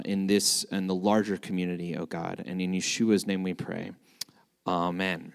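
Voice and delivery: soft, low prayer voice. Acted. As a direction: pray quietly and reverently.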